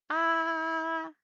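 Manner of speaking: "Ah" held high and level, as at the dentist; high flat tone. Shown as a demonstration. Teacher imitates dental patient saying "Ah", high and flat. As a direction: open wide and sustain a high "Ah". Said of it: "When we go to the dentist".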